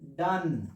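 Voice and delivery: "डन" for don't, short vowel and no t; short spoken pronunciation. Shown as a demonstration. Teacher answering how to say it short, reduced pronunciation. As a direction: say don't as a low, soft "डन". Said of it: "short में बोलना चाहते हो तो क्या बोलोगे".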